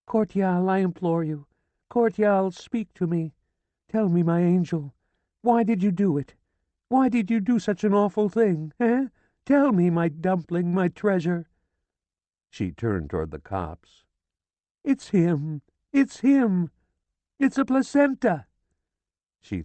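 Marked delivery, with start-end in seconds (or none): 0.1-11.5 high, begging to the dead husband; tearful pleading. A narrator's reading. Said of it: "She was shivering". 14.8-18.5 high, loud, rising to a scream; hysterical cry. A narrator's reading. She screams to the cops.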